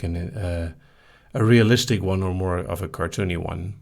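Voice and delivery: ordinary talk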